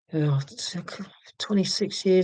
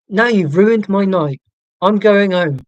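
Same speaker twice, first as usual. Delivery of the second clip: louder, slower, hammed-up huff at the pub stranger; mock indignant outburst; 5 semitones higher, 7 dB louder, slower than usual. To a pub stranger who said cheer up, mock-indignant loud huff. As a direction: play it as a fake storm-off, louder and drawn out.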